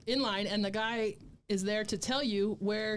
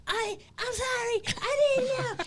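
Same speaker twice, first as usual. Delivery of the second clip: high-pitched mimicked apology; mock pleading; 14 semitones higher than usual. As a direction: imitate a panicked man begging off, voice way up. Mimics man begging while being punched.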